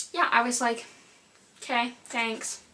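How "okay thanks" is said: underwhelmed retort. Retold or imitated. Retold reply to unhelpful store worker.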